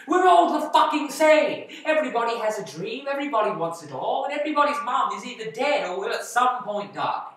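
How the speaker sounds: loud, high outburst, easing slightly; angry rant. Acted. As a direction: rail at how identical everyone is. Ranting that contestants are all alike.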